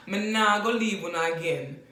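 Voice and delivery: Jamaican accent, playful promise; Jamaican patois. Shown as a demonstration. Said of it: "Me not going to leave".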